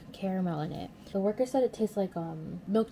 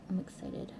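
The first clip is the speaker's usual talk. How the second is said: whispering; 2 semitones lower, 8 dB quieter than usual. Real, not acted. Whispered aside.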